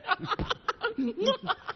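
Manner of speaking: high, staccato burst of laughter; delighted cackle. Acted. Salesman overjoyed at a sudden sale, cackling.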